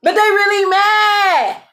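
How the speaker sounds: high, drawn-out crowing; stretched high-pitched exclamation; gleeful holler. Real, not acted. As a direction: sing it out high and slow, relishing it. Gleeful exclamation about the haters.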